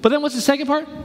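ordinary talk, nothing marked

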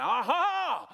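loud, high, drawn-out gotcha; hammed-up burst of discovery; triumphant aha. Skeptics catching a contradiction, mock-triumphant. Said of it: "your red flags just went up".

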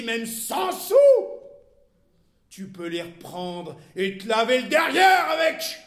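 loud, high jeering tirade; furious scorn. A narrator's reading. Wife mocking her husband's bought nobility, furious scorn. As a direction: hurl his name and title back at him.

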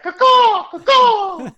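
high loud squawking falcon caws; falcon screech imitation. Dad mimics falcon to spook son.

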